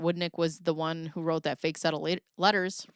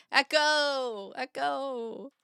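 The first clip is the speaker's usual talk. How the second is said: echoing voice into an imagined cave; mock cave echo; 5 semitones higher, much slower than usual. Joking about spelunking gear, playing a cave echo. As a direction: call out as if into a cave, letting it fade.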